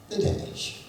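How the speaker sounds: unvoiced soft announcement; almost breathe the words like a secret; quiet dash. Shown as a demonstration. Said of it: "this might work".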